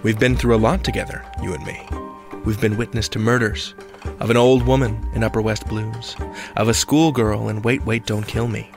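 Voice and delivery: ordinary talk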